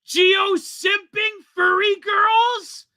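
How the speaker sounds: high slow shouted name; exclaimed name. Real, not acted. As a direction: belt out the name, drawn out. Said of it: "he screwed it up".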